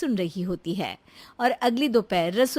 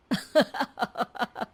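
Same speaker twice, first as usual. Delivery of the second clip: light running chuckle; amused laugh; 2 semitones higher than usual. Acted. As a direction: laugh heartily at the joke. Husband laughs at the joke.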